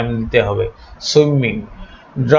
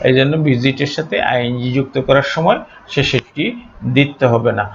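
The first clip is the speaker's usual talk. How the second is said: ordinary delivery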